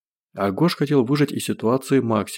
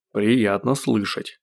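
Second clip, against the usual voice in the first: ordinary delivery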